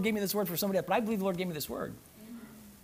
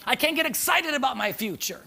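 usual speaking voice

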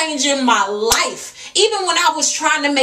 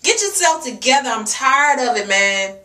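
ordinary delivery

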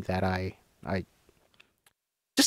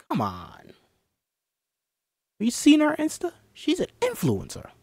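mocking influencer voice.

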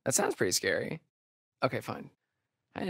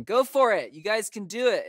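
loud, high shouted cheer; rally them with a big yell; encouraging cheer; 10 semitones higher, 6 dB louder than usual. Acted. Cheering them on to hurry up, loud high shout. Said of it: "I can try to encourage them".

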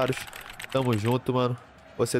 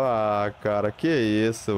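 usual speaking voice

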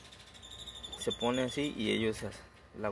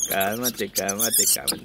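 loud calming; 8 dB louder, slower than usual. Real, not acted. Calming an agitated rabbit.

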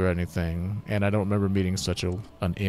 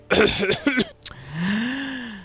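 strained cough from the voice; cough and laugh. Real, not acted. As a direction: cough then break into laughter. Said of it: "this voice is killing me".

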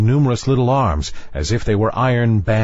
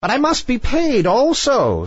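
very high witch voice; grasping witch, pitched way up; demanding sea witch. A narrator's reading.

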